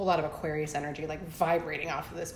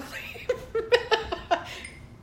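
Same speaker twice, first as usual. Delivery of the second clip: high laugh. Real, not acted. Laughing at own remark.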